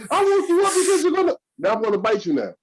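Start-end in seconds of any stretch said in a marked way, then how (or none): 0.1-1.3 mocking whiny voice. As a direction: squeak it out, pitched way up. Voicing the stick-poker.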